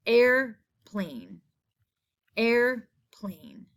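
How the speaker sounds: "air... plane", a pause inside the word; syllable by syllable. Shown as a demonstration. Teacher letting the class hear the A, "Airplane" split in two. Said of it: "I hear that A".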